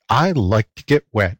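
usual speaking voice

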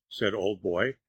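usual speaking voice

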